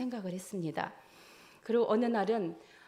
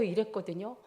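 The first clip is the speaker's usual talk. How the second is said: ordinary delivery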